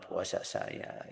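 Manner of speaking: whispered prayer. Retold or imitated. Tearful prayer quoted.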